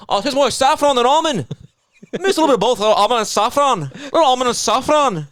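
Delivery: high-pitched mock-Scottish voice; squeaky-high caricature of a Scottish accent. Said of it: "the Scottish one. He was like".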